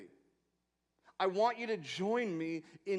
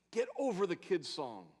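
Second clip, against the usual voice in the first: usual speaking voice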